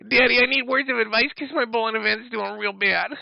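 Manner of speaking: high, whining mock baby voice pleading to daddy; mock him as a crying toddler begging dad; whiny child voice. Streamer mocking his rival's message in a whiny high voice.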